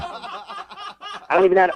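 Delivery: laughing in disbelief. Real, not acted.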